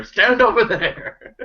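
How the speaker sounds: high, slow shout through laughter; laughing shout. Retold or imitated. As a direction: yell it while laughing. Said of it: "shout out to Dacre".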